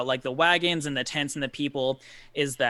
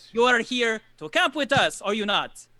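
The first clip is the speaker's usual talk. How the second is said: higher-pitched, emphatic character voice; Jarek, insistent host; 7 semitones higher, 3 dB louder than usual. Acted. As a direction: explain eagerly to the confused newcomers. Game master voices Jarek.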